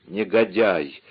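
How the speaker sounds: ordinary delivery, nothing marked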